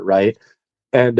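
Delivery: ordinary talk, nothing marked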